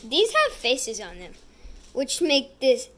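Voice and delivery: ordinary delivery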